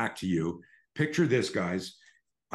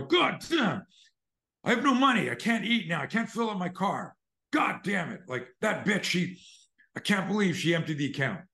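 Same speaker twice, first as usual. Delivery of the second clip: acted frustrated rant; 10 semitones higher than usual. Shown as a demonstration. Demoing the ATM devalue trick for listeners: furious rant. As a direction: blow up as if broke and betrayed.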